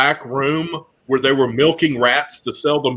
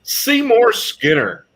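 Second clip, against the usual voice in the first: drawn out slowly, pitched higher, like a show host; slow, higher grand reveal of the next guest; dramatic announcement; 8 semitones higher, slower than usual. Acted. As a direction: announce the name with mock fanfare.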